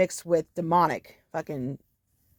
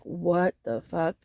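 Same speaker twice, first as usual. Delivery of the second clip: stunned; 4 dB quieter, much slower than usual. Retold or imitated. Shocked reaction to confession.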